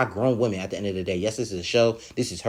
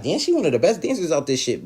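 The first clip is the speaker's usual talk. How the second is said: higher-pitched, lively disbelief; shocked; 7 semitones higher, 3 dB louder than usual. Retold or imitated. Reacting to one of the best dancers leaving, shocked. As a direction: protest it in surprise. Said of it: "I was really shocked".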